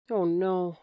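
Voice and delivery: ordinary delivery, nothing marked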